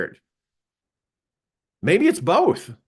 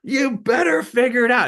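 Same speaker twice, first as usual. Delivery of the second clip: high-pitched, forceful order; retold screaming boss; 10 semitones higher than usual. Boss screaming at his struggling salesman on the phone, retold yell. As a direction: holler it as an angry boss's ultimatum. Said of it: "he's screaming at me".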